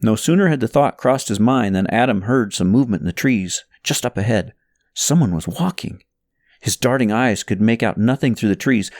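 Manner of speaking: ordinary delivery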